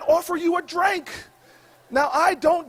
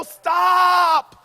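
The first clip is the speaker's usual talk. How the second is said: long drawn-out shout of alarm; retold scream; 3 semitones higher, 4 dB louder than usual. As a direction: yell it out, stretching the vowel. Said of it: "I scream at her".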